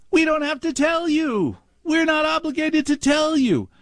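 high snooty clerk voice; mocking bank clerk. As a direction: parrot the smug refusal, high and prissy. Mimicking bank customer service.

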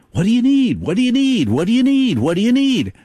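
a buzzing helper bee, desperate to please; eager drone bee. Pretend.

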